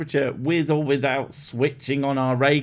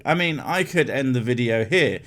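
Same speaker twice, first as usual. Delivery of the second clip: ordinary delivery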